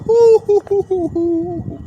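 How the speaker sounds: high hooting whoop, trailing longer; excited hooting. Real, not acted. Preacher rejoicing at a crown of life, high excited hooting. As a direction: let out a string of high hoots.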